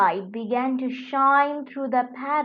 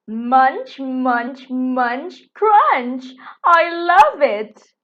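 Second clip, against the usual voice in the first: crunch it out with glee, louder and louder; delighted beetle; 9 dB louder, slower than usual. Acted.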